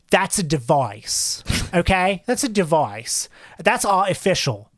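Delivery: lightly put-on British accent, slow and pointed; a casual British voice, drawn out on the key words. Host mimics Baz Luhrmann in a light British accent. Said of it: "I give him a British voice".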